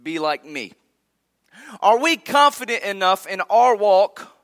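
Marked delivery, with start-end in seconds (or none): 0.0-0.7 dropped low and soft on 'Be like me'; a hushed dare to the congregation; soft quoted challenge. Retold or imitated. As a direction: let "like" fall away quietly. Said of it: "Could we tell others".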